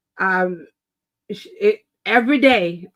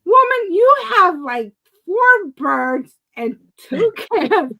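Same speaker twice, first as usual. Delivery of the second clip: incredulous outburst; 8 semitones higher, 5 dB louder, slower than usual. Real, not acted. Scolding her mother-in-law in disbelief.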